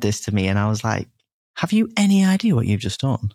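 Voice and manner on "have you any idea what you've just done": much higher, amazed question; excited disbelief. Retold or imitated. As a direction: burst out in amazement at dad. Telling dad what he achieved.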